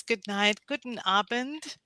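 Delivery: ordinary delivery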